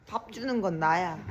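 ordinary delivery

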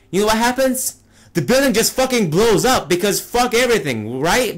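high, indignant rant; incredulous, worked-up complaint; exasperated outburst. Real, not acted. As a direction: let the disappointment burst out, pitch way up. Exasperated reveal of film's letdown.

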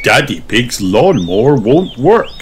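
usual speaking voice

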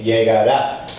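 Llegará with the last syllable rá stressed; stress on the final A. Shown as a demonstration. Teacher showing students where the stress falls, on the final A. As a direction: push the rá of Llegará. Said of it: "emphasis on the A".

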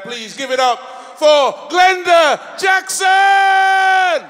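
high, loud, stretched-out introduction; announcer shout. Real, not acted. Host brings on next comedian, drawn-out announcer shout. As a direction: build up the name for the crowd.

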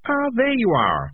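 high, drawn-out greeting; cheerfully. A narrator's reading. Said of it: "said Morris cheerfully".

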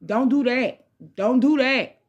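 normal talking voice

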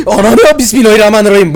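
gasping, high shrieked prayer of fright; jolted by a sudden scare, shriek it out; startled scream. Real, not acted. Gamer startled by a jump scare, high frightened shriek.

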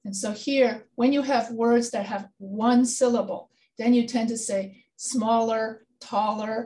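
usual speaking voice

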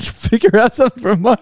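high, disbelieving repeat through a laugh; laughing, can't believe it; incredulous echo. Retold or imitated. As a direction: throw the words back in disbelief. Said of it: "I was like".

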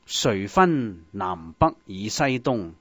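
ordinary talk, nothing marked

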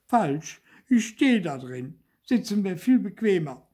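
high, loud little puppet; cheeky contradiction. A narrator's reading. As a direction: snappily correct her, high and loud. Trapped puppet contradicts fairy. (hoch und trotzig)

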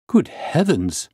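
high, drawn-out exclamation; shocked cry. A narrator's reading. Doctor sees the severed thumb, crying out in shock. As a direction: exclaim slowly in horror. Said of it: "I cried".

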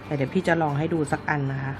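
usual speaking voice

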